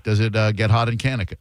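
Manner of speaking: normal talking voice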